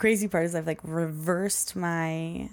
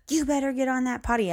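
higher, louder mock yell at a little girl; imitating mom yelling; 8 semitones higher, 3 dB louder than usual. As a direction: play the mad mom, half-hearted yell. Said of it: "yelling at this little girl".

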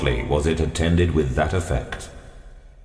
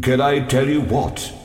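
high, startled question; sudden alarm in the voice; 8 semitones higher than usual. A narrator's reading. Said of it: "noting his stoppage with quick alarm".

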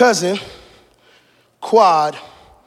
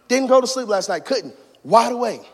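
ordinary talk, nothing marked

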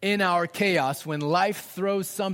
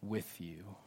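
low, soft whisper of a loving father; 10 semitones lower, 12 dB quieter than usual. Acted. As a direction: whisper it close and tender. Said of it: "the whisper of his father saying".

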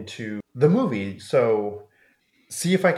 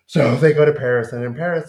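ordinary delivery

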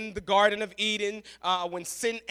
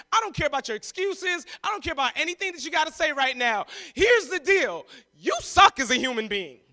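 blunt confrontation; 5 semitones higher, 6 dB louder than usual. Retold or imitated.

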